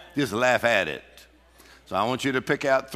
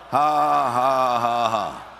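loud forced ha-ha, falling away; demonstrative laugh; 3 dB louder, much slower than usual. Shown as a demonstration. Preacher leading the congregation in laughing practice, forced laugh. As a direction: laugh deliberately, long open ha's. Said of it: "Go ahead and practice that".

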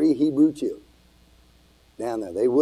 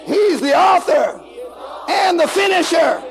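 preaching at full force; 11 semitones higher, slower than usual. Acted. Preacher to his congregation, rising to a shout.

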